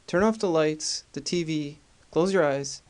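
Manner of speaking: normal talking voice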